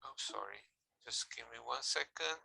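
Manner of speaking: soft whispered apology; muttered aside while writing. Real, not acted.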